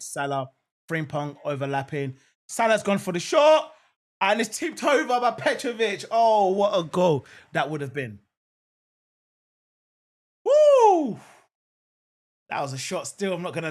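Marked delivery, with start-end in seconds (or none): 2.5-8.2 raised, louder commentary burst; excited near-miss call. Real, not acted. Watchalong host reacting to Salah's shot tipped over, excited. 10.4-11.2 loud, high whoop; excited woo. Real, not acted. Fan host after a near miss, loud excited whoop.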